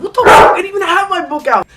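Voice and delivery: furious at the false claim; student protesting to the principal; outraged shout. Retold or imitated.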